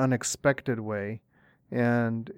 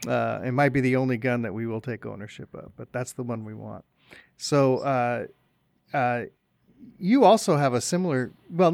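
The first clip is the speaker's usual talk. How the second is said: ordinary delivery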